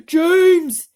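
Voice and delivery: high, very loud shout of a name; frantic shout. A narrator's reading. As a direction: scream his name in panic. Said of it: "cried the earthworm".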